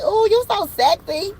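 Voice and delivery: high, slow flirty coo; mock smitten. Querent admiring the new boo, mock smitten. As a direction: draw out the compliment way up high.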